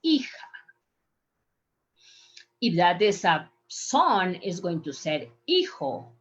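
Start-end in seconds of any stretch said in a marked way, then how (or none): 0.0-0.2 "hija" begun straight on the vowel, EE-ha; silent H. Shown as a demonstration. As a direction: say "hija" with no H at the start. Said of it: "don't pronounce the H and just say it".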